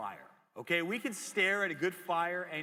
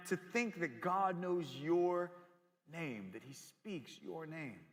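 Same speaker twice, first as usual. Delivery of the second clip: usual speaking voice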